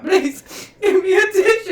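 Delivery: high, loud laughing-crying plea; retelling begging her partner for a tissue while crying; mock teary plea. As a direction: beg for a tissue through mock tears. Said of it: "I'm like".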